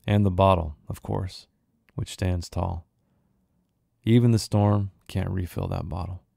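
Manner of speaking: measured, unhurried storyteller reading; story narration. A narrator's reading. Author reading his short story to viewers, steady narration.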